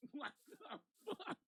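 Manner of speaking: high, soft, slow squeak of disbelief; laughing mock disbelief. Quoting his friend's reaction, high and choked with laughter. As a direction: wheeze it out slowly through laughter.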